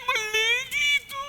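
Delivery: high, slow mock call; calling out that it's wrong; retold call. Fans calling out at the team, high and drawn out. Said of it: "Sabi namin".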